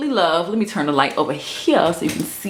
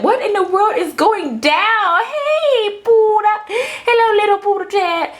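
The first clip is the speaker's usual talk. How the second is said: much higher squeaky cat voice; high sing-song pet voice, playful; voicing the cat; 13 semitones higher than usual. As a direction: speak for the cat in a tiny high voice. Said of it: "This is like".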